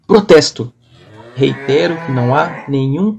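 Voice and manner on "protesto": sudden loud objection; loud protest. Acted. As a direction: object loudly. Objecting in meeting.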